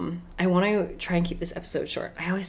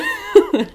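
real laugh; 7 semitones higher, 8 dB louder than usual. Real, not acted. Laughing at herself.

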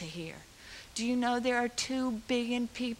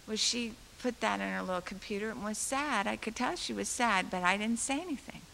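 normal talking voice

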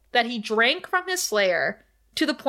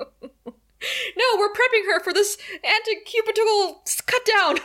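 higher, laughing imitation of a flustered nurse; playfully voiced nurse, pitched up; mock nurse voice; 11 semitones higher than usual.

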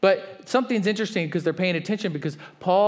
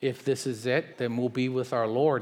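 calm trust. Retold or imitated. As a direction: answer like someone at peace in a storm. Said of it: "And he's like".

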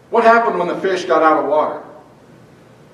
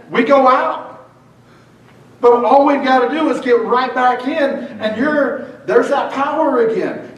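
raised, fervent preaching voice; 5 semitones higher than usual. Real, not acted. Pastor preaching to the church, raised and fervent. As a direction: preach it out to the congregation.

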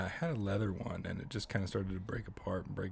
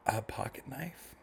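whispering; 5 semitones higher, slower than usual. Real, not acted. Announcing starter pack item.

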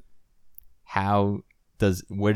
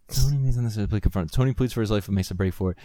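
ordinary talk, nothing marked

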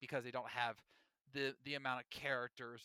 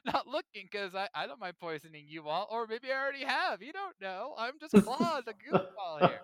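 higher, louder sly schemer voice; playful Claude imitation, higher and loud; mock Claude; 6 semitones higher, 8 dB louder than usual. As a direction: ham up a carefree schemer letting slip his plans. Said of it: "He's like".

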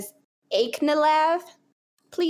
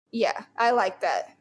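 normal talking voice

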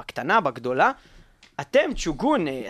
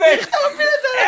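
mock shout; 6 dB louder than usual. Mock quarrel between hosts.

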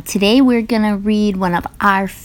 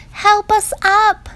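high, mock cry for help; 13 semitones higher, 4 dB louder, slower than usual. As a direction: squeak it out like a small letter in trouble. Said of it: "cried A B C".

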